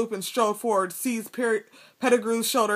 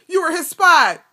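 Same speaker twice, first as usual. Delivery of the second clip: loud, higher accusing bellow; 7 semitones higher, 7 dB louder than usual. A narrator's reading. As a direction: nail him with it.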